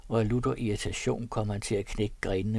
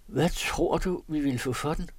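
higher-pitched, persistent questioning; insistent; 6 semitones higher than usual. A narrator's reading. Keeps arguing over the horse's price, insistent. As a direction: press the point, pitch raised. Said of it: "vedblev den forhåbningsfulde påståelig".

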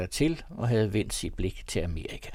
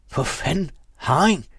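higher, louder burst of surprise; surprised exclamation; 7 semitones higher, 6 dB louder than usual. A narrator's reading. Sailor recognising his old friend, surprised exclamation. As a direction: blurt it out at the sight of an old friend. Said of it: "udbrød sømanden".

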